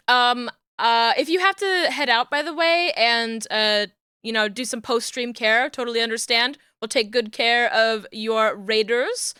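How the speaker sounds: usual speaking voice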